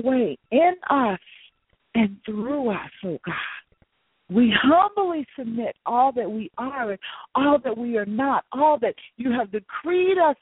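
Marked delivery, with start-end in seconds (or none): none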